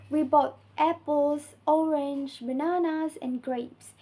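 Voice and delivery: rising items, falling final "grapes"; list intonation. Shown as a demonstration. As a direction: lift "apples", "orange", "bananas", drop "grapes". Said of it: "list, except for the final item".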